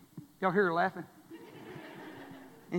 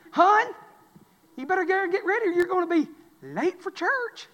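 higher-pitched wife calling him; 8 semitones higher than usual. Retold or imitated. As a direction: lightly mimic the wife hurrying him.

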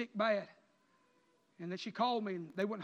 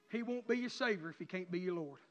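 ordinary talk, nothing marked

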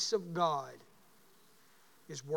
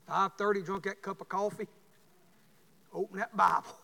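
ordinary talk, nothing marked

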